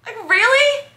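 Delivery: high-pitched, wounded indignation; hurt protest. Acted. Girlfriend always accused of being the cheater, hurt protest.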